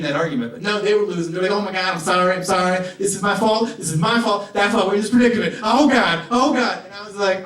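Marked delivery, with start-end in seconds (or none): 1.5-6.8 higher, louder, panicky apologizing; wailing imitation of a frantic street man; frantic apology. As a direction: play a crazed man losing an argument to nobody. Imitating crazy homeless arguing.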